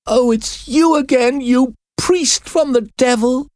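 put-on high, desperate girl's cry; crying in despair. A narrator's reading. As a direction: fling it at him in despair. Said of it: "the girl cried with despair".

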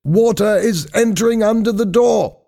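high-pitched alarmed report; worried shouting. A narrator's reading. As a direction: raise the alarm from below, very high. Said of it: "now his voice sounded worried".

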